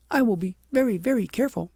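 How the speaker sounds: put-on a girl eagerly promising; young princess. A narrator's reading. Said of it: "said the princess".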